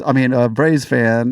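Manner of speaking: ordinary talk, nothing marked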